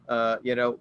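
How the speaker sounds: usual speaking voice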